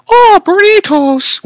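high, slow mock Irish lilt; light mock Irish accent; Irish girl accent. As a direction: imitate a passing Irish girl. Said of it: "never hear in that accent".

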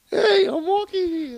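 very high mock Brooklyn accent. Co-host copies the Brooklyn professor bit, high Brooklyn accent. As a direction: echo the Brooklyn pedestrian in a high caricature. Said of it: "if he grew up in Brooklyn".

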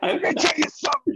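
high, gravelly wrestling promo yell; Hulk Hogan promo voice. Retold or imitated. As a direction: hype it up like a wrestler. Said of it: "go full Hulk Hogan".

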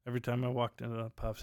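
ordinary talk

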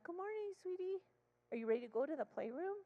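put-on high-pitched sing-song coaxing of a child; sweet coaxing. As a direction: greet a wary little one sweetly. Therapist greets wary child.

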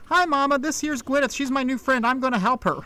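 put-on high-pitched country child; little girl piping up. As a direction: pipe up to Mama like a small girl. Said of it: "the little girl pipes up".

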